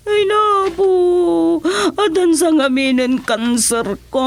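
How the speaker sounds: breaking into a high sob; crying. Acted. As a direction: voice cracking into tears over her sickness. Sick woman tells of her illness.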